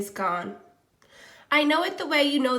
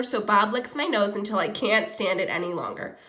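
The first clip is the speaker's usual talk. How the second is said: plain reading voice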